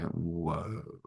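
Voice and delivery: very soft, low "whoa"; acted onlookers' amazement under the breath; hushed awe. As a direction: breathe out a low, awestruck reaction. Stunned onlookers react in awe.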